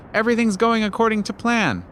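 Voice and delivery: high, pleased little trickster voice; a pleased villain's aside, voiced high; high gleeful spider. A narrator's reading. Anansi playing the schemer.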